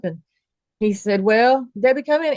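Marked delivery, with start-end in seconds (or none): 1.2-2.3 pitched-up, raised voice calling someone in; retold calling out. Daughter imitates Daddy calling her in, lightly calling out. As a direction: imitate a dad calling his child into the room. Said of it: "He called me in there".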